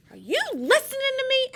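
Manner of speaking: very high, loud, fast indignant call; Sea Slug shouting. Acted. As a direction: yell to be heard over the singing. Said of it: "asked Sea Slug".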